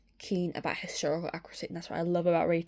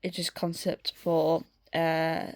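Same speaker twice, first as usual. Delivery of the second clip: usual speaking voice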